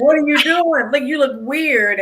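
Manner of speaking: high, loud, laughing self-scolding; mock scolding of herself. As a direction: chide yourself, half laughing.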